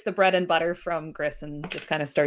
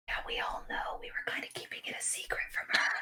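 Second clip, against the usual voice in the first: hushed aside, fully whispered; confiding whisper; 12 dB quieter, faster than usual. Acted. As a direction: lean in and whisper it like a shared secret. Leon leans over to confide.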